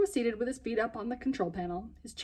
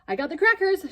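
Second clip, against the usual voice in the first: breathless; 10 semitones higher, 6 dB louder than usual. Acted. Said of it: "she said breathlessly".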